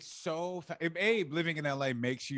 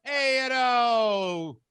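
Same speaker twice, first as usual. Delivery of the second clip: high, loud, slow drawn-out buddy cop; hammed-up easygoing cop; friendly NYPD cop; 8 semitones higher, 9 dB louder, slower than usual. NYPD cop to the teller, loud slow buddy voice. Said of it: "the N Y P D cops are exactly that of".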